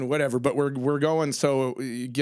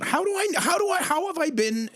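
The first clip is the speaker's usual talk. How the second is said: stunned disbelief; 14 semitones higher than usual. Real, not acted. Stunned squeak to a colleague of twenty years. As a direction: voice jumps way up in disbelief. Amazed she never knew.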